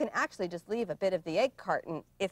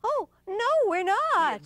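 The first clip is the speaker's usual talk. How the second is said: high anxious protest; 11 semitones higher, 4 dB louder than usual. Acted. Zebra protests to leopard.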